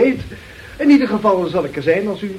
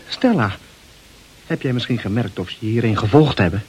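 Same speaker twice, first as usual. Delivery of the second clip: ordinary delivery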